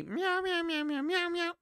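high meows like a cat; cat meowing. Pretend. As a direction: meow like a little cat. Filter macht ihn zur Katze.

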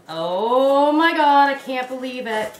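long, loud swelling cry of amazement; real overjoyed disbelief. Real, not acted. Friend opening a longed-for gift, overjoyed disbelief. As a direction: draw it right out, loud and thrilled.

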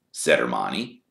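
ordinary delivery

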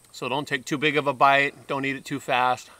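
normal talking voice

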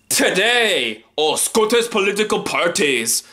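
Scottish accent. Shown as a demonstration. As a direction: put on a mock Scottish accent. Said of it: "do that for my Scottish accent".

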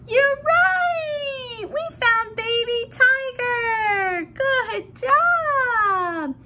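high, bright celebration; thrilled storybook praise; excited cheer. Pretend. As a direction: cheer the baby on. Celebrating finding tiger with baby.

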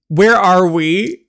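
high, loud, slow question; alarmed demand. Acted. Captive girl asks where the car has stopped, alarmed demand.

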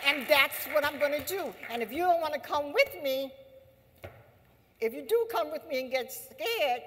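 mock-heroic determined vow; voicing the heroes. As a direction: speak as a fearless freedom fighter. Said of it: "They said".

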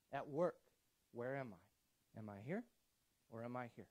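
ordinary delivery

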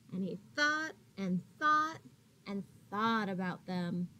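gentle, expressive read-aloud voice; reading to children. A narrator's reading. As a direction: read it like a picture book for little kids.

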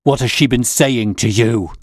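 a loud, raging scream at Holmes; screaming with rage; furious scream. Acted. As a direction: bellow the question in fury. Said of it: "screamed the old man furiously".

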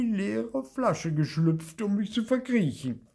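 high, loud ghost wail; terrified little ghost, pitched very high; frightened stammer. A narrator's reading. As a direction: pour out the scare tearfully. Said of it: "stammelte Bibi".

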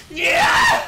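very high, loud cheer; real yell of joy; ecstatic yelling. Real, not acted. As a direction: scream it in triumph. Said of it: "I'm yelling so much".

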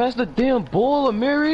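high, slow, drawn-out yell for the ball; frustrated demand. Real, not acted. Gamer yelling at Amiri to pass the ball.

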